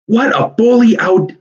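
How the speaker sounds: high, loud burst of delight; excited shout. A narrator's reading. Warren cheering Tom's idea, shouting. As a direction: shout it out with glee. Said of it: "shouted Warren".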